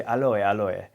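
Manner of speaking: "Aloe" said the Japanese way, ah-lo-eh; Japanese-accented aloe. Retold or imitated. Imitating his parents' Japanese-English "Aloe", ah-lo-eh. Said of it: "So they would say".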